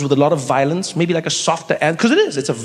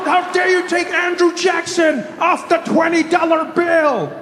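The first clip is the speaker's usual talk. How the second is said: much higher, shouting mock outrage; outraged people; 12 semitones higher, slower than usual. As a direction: scream it like an offended patriot. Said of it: "They're like".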